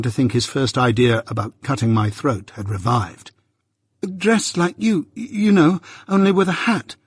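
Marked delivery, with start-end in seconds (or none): 4.0-7.0 trembling. A narrator's reading. The frightened boy explains to the convict, trembling and stammering. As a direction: get the words out shakily. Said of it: "I explained, trembling".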